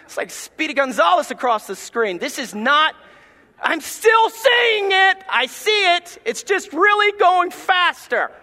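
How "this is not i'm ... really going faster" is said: high, slow, loud mock kid shout; whining shout. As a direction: yell it like a kid who still sees everything. Kid protests fast-forward.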